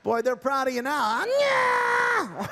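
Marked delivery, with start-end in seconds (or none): 1.4-2.5 puppet lady voice. Acted. Ventriloquist voices volunteer lady puppet.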